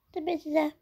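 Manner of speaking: usual speaking voice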